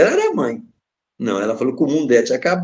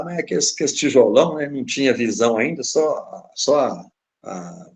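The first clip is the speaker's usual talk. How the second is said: ordinary talk, nothing marked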